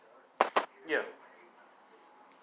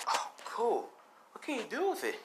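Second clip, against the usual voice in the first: eager curiosity; 8 semitones higher, 7 dB quieter than usual. Acted. Mina curious about his quirk.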